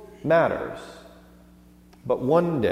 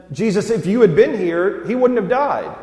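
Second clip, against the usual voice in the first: pleading cry; 8 semitones higher, 6 dB louder than usual. Acted. Martha's anguished plea.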